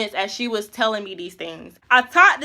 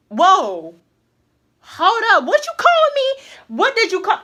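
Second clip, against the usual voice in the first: indignant; 7 semitones higher, 3 dB louder than usual. Retold or imitated. Angry retort to friend's warning.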